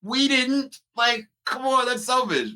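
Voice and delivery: hammed-up falsetto complaint; mock indignant. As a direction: act wounded and offended. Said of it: "I was like".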